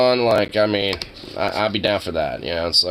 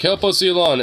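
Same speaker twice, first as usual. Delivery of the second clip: pleading fan; 10 semitones higher, 4 dB louder than usual. Retold or imitated. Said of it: "every Musk fan's like".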